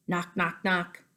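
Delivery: ordinary talk, nothing marked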